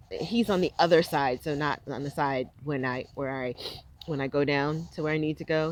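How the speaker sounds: normal talking voice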